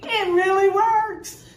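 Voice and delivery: half laughing, delighted. Real, not acted. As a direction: still laughing at the trick.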